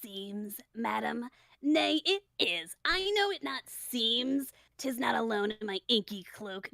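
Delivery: slow, deliberate tragic delivery; brooding Hamlet. Acted. Hamlet answering his mother about grief, slow brooding delivery. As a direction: weigh each phrase of the verse.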